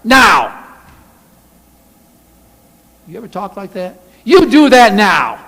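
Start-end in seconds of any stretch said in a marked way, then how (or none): 0.0-0.6 loud, slow angry demand; angry shout. Acted. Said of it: "they shouted at Moses". 4.2-5.4 angry scolding. Acted. Angry crowd scolding Moses.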